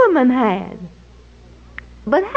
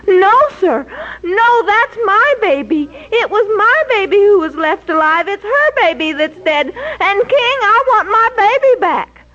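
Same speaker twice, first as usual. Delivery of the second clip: higher, firm claiming voice; insistent woman; 8 semitones higher than usual. A narrator's reading. Second woman telling the king, insistent and raised. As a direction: insist the child is yours.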